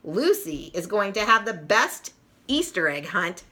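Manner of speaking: usual speaking voice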